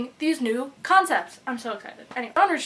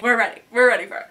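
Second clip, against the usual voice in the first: eager declaration; 9 dB louder, faster than usual. Acted. Voicing eager readers after Christmas.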